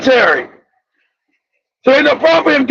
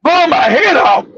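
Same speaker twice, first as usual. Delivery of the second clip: startled disbelief; 3 semitones higher than usual. Retold or imitated. Acts out hearing death threat.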